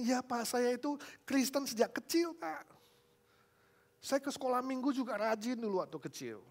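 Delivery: high, whiny tearful protest; acted whimpering self-defence; imitated tearful man. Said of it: "Dia bicara sambil nangis".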